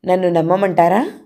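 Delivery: ordinary delivery, nothing marked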